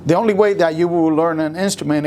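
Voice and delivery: usual speaking voice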